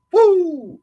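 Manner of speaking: high, loud derisive woo; sarcastic high woo; mocking whoop. Real, not acted. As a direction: holler it out to taunt. Mocking cheer at insult.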